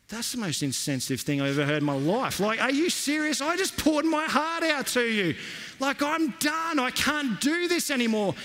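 high, outraged mock disbelief; indignant disbelief. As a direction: play up the hurt outrage. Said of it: "And I'm like".

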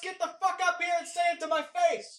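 New York street-fight yell; furious shout. Acted. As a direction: scream up at the neighbor. Yelling back at neighbor.

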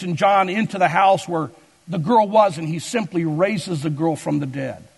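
ordinary talk

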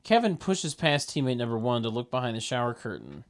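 ordinary talk, nothing marked

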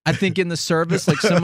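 ordinary talk

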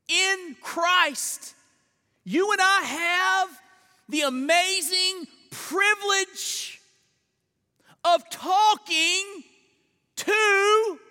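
high, loud, slow proclamation; ringing pulpit declaration with weighty pauses; emphatic preaching. Real, not acted. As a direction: proclaim it with awe, stretching the phrases. Preacher proclaims the amazing privilege.

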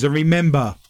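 ordinary talk

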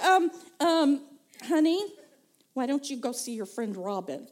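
hesitant husband, halting between phrases; cautious, stumbling suggestion; uncomfortable husband. Retold or imitated. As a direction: tiptoe awkwardly round a crying wife. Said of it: "he was uncomfortable with this emotional outburst".

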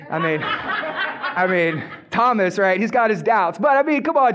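ordinary talk, nothing marked

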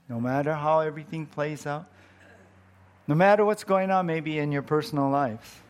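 ordinary delivery

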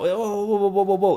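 high mock-alarmed vendor voice; startled vendor. Vendor protesting the lowball offer, high and alarmed.

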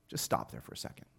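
whispered pause to the room; hushed aside. Real, not acted. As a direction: drop to a whisper to stop them.

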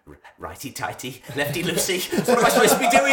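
mounting panic, pitch climbing to a squeak; flustered rhyme rising into a laughing wail; panicked. Retold or imitated. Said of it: "I'm there going".

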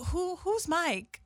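high, slow, blank mock question; clueless friend. Teller mimics clueless friend asking about Mike, high and slow. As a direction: play the friend who has no idea who Mike is.

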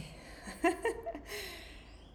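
high giggling laugh. Real, not acted. Laughs before starting topic. (petit rire aigu)

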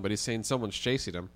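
ordinary delivery

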